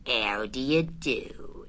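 low, soft and slow, heavy with gloom; gloomy. A narrator's reading. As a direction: greet him like nothing will ever go right. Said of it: "in a gloomy manner".

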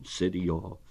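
ordinary delivery

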